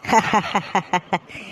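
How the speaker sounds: high, scoffing ha-ha-ha; mocking laugh. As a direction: laugh it off as ridiculous. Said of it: "கேக்கே சிரிப்பா இருக்குமா". (கேலிச் சிரிப்பு)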